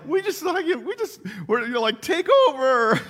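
high, loud, hammed-up charge; gung-ho battle cry. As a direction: rise into a mock invading-army shout. Retelling kids' gung-ho backyard invasion.

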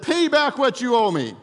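loud, high, furious demand; angry demand. Acted. Servant choking a fellow servant, shouting furiously. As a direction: bark the debt back at him.

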